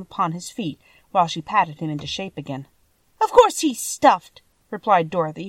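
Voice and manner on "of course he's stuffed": put-on cross girl, high, slow and loud; still angry. A narrator's reading. Dorothy answers the lion's question, still angry. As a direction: answer with exasperated force. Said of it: "who was still angry".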